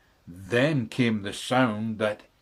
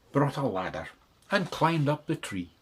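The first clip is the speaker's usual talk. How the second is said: usual speaking voice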